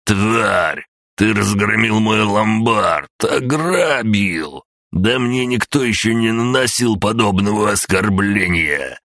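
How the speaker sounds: put-on high, slow, raging old man; furious old man. A narrator's reading. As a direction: scream the insult at him. Enraged old man ranting. (яростный крик старика)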